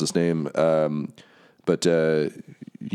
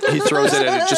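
laughing; faster than usual. Real, not acted. GM laughing at the throw.

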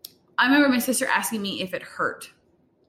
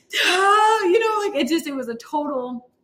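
crying retort; 9 semitones higher, 3 dB louder than usual. Retold or imitated. Crying mother answers husband.

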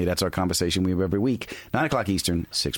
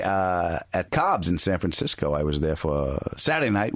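ordinary delivery

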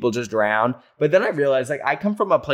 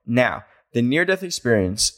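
ordinary delivery

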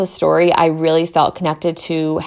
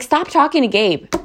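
exasperated retort; 8 semitones higher than usual. Retold or imitated. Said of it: "I'm like".

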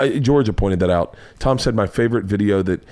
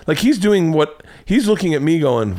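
usual speaking voice

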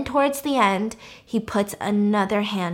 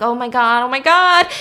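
rising, louder horrified gasping words; mock panic; 3 semitones higher, 8 dB louder than usual. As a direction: escalate like a horrified viewer. Imitating horrified viewer's reaction.

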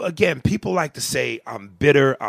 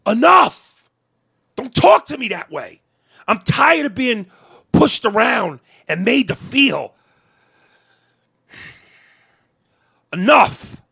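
loud, higher inner shout of enough; a loud, higher outburst against being pushed around; 4 semitones higher, 6 dB louder than usual. Retold or imitated. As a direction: let the pent-up anger burst out loud. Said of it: "in my head".

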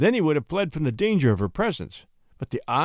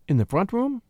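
higher, quick lady's query; eager question; 5 semitones higher than usual. Acted. Lady asking which room, higher and eager. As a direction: ask with sudden interest.

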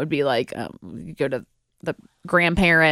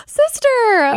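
high, louder mock-puppy cry of joy; excited reunion squeal; 4 dB louder than usual. Puppy greeting her sister when they meet, excited squeal. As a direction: squeal it like a long-lost sibling spotted.

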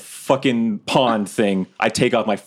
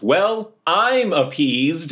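much higher, slow, grandly declared; loud announcement; 7 semitones higher, much slower than usual. Acted. Quinny announcing to the brothel that he is satisfied, grand and drawn out. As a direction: proclaim it for the whole room. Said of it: "Quinny loudly announces".

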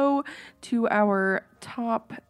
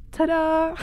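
cheery ta-da; 7 semitones higher than usual. Retold or imitated. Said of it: "she looks at him and goes".

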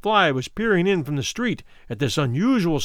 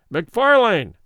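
loud call; 5 semitones higher, 5 dB louder than usual. A narrator's reading. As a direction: call the name out loud, like a herald. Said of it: "he said somewhat loudly".

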